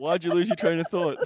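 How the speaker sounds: loud, high, laughing question. Real, not acted. Teasing after a shared laughing fit.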